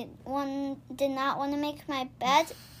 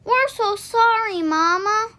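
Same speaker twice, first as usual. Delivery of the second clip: higher, louder, slow pleading apology; sorry little rabbits; 7 semitones higher, 6 dB louder, slower than usual. A narrator's reading. Rabbits run home to say sorry to mama, high and pleading. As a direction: beg mama's forgiveness. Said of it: "cried the little rabbits".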